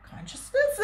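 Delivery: consciousness asked back very high, laughing; amused surprise. Retold or imitated. Surprised echo of stranger's word.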